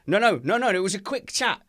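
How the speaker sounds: high, loud hasty denial; flustered protest. Acted. Acted excuse, caught chatting.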